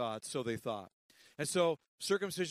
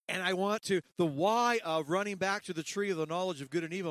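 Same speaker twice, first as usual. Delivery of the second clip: louder, rising preacher's push; preaching; 3 semitones higher, 5 dB louder than usual. Real, not acted. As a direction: drive it home to the congregation. Preaching at full force.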